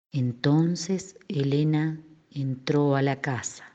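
hushed slow narration; quiet and slow. Shown as a demonstration. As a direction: murmur it slowly, holding back. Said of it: "Te doy un ejemplo".

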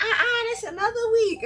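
high, laughing mock woman voice; sassy caller voice. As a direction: play the caller talking back, laughing. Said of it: "She could be like".

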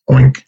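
a cheerful pig grunt; happy oink. Acted. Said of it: "replied the pig happily".

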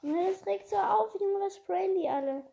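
loud, high frustrated outburst; angry rant. Real, not acted. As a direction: vent it like a raging gamer. Raging at the enemies.

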